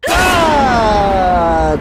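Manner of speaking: long high shriek, sliding down; real scream of fright; startled scream. Real, not acted. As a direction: let out a long scream that falls away. Jump scare in horror film.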